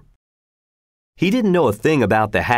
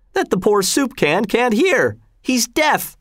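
very high, mocking jeer; mock shout; 12 semitones higher than usual. A narrator's reading. Friend making fun of silent soldier, mock shouting. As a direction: jeer in a high call, climbing at the end. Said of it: "shouted a friend".

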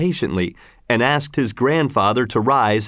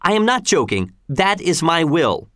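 higher-pitched, mildly stern insistence; 6 semitones higher than usual. A narrator's reading. Governor refusing to reconsider, mildly stern. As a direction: insist on it with understated severity. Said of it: "said Gessler sternly".

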